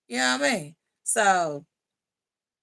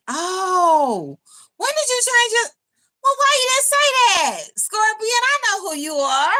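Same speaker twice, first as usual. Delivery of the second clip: surprised; 13 semitones higher, 6 dB louder than usual. Real, not acted. Viewer reveals their new username.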